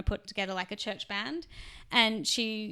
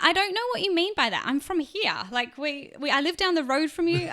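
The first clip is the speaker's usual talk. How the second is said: higher-pitched mock protest; retold bewildered protest; 6 semitones higher than usual. Answering people who ask her origins, bewildered protest. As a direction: protest that you are a local.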